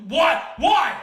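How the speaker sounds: why, why, why, rising to a shout; insistent why. Real, not acted. Demanding an explanation, rising.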